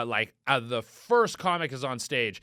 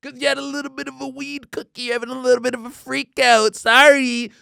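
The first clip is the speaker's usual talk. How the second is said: high whiny taunt building louder; sarcastic mock sympathy; 8 semitones higher, 8 dB louder than usual. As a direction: sneer in a babyish high voice. Mocking the man's excuse.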